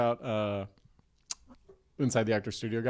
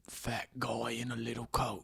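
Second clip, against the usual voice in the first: soft, breathy invite; hushed Statham voice; 9 dB quieter than usual. Retold or imitated. Jason Statham asking a comedian to hang out. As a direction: murmur it, shy and eager. Statham quoting Tommy Boy.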